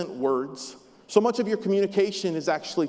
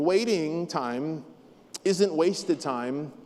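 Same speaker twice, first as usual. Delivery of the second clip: slow emphasis on each word; slow, deliberate; 3 semitones lower, much slower than usual. Shown as a demonstration. Preacher stressing the key truth to listeners, slowly. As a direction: lay out every word slowly. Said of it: "emphatic slow delivery".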